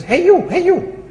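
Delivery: high squawky parrot call. Acted. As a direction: call out as a cheeky talking bird. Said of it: "And the bird says".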